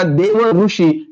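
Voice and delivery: दोन words drawn out very slowly; देव ऋषी said very slowly; slow example. Shown as a demonstration. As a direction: stretch देव ऋषी for the class. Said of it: "बघा".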